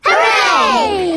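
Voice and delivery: loud happy hooray; cheering. Real, not acted. Children promised balloons, cheering loudly. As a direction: cheer with all your might.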